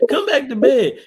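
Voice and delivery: playful mock wife; wife calls husband back to bed. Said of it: "she said".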